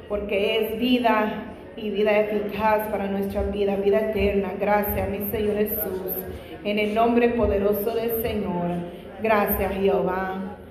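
ordinary talk

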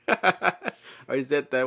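words broken up by giggles; laughing. Real, not acted. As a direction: talk through a laughing fit. Cracks up at repeating herself.

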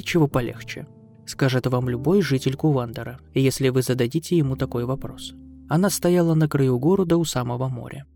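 plain reading voice

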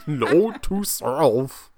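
very slow, slurred Muppet voice; drunk Muppet. Retold or imitated. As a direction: drag it out like a drunk puppet. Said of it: "a drunk Muppet".

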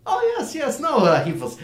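very high, loud hasty assurance; over-eager dwarf merchant. Acted. Dwarf answers Burden's doubt about Billy, high over-eager assurance. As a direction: jump in high and eager to reassure.

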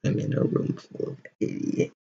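whispered under the breath; muttered aside. Real, not acted. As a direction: mutter it to yourself, fed up. Exasperated aside to the room.

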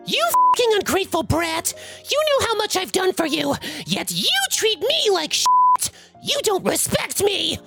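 high-pitched screaming-mom voice, little added volume; intense screaming. A narrator's reading. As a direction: rant in a high, furious mother voice. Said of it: "Cue the intense screaming".

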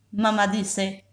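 plain reading voice

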